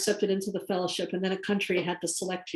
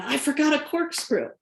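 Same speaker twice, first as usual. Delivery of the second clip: retold curse; 6 semitones higher than usual. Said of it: "his wife in the backseat curse".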